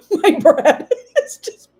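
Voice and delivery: laughing through the words; breaking into real laughter. Real, not acted. Peanut butter pours out of bread.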